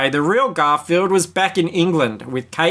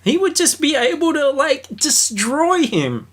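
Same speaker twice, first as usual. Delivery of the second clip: pitched way up in gleeful delight; 11 semitones higher than usual. Real, not acted. Cohost gloats over Dargis suing John, gleeful and high. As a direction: relish the thought of John getting destroyed.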